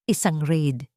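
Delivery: usual speaking voice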